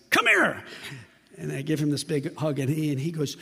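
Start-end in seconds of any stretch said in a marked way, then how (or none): 0.1-0.6 high, loud, eager summons; imitated calling out. Jerry calling the narrator over, high imitated shout. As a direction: act out hailing someone across the room. Man calls him over, retold.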